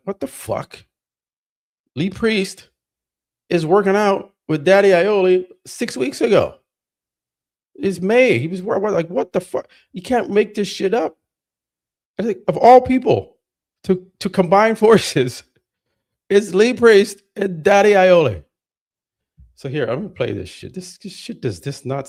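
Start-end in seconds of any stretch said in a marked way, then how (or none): 0.1-11.2 pitched high in disbelief; stunned disbelief. Retold or imitated. Reading the comment, shocked and higher. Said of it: "I'm like". 12.2-18.4 incredulous outburst. Real, not acted. Incredulous ranting about pairing.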